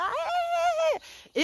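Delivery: high calls trailing off; high hails fading away; mock yell. Chasing the van, high calls to the driver. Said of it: "et je suis là".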